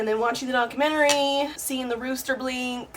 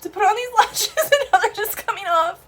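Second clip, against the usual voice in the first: higher and louder, a laugh breaking in; laughing through tears; 9 semitones higher, 6 dB louder than usual. Real, not acted. Vlogger losing her lash mid-cry, laughing. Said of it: "my lash is coming off".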